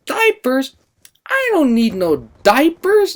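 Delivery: put-on high, slow, offended old-lady voice; indignant grandma. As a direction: huff it like a proud grandma. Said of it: "she's like".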